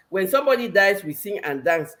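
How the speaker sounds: normal talking voice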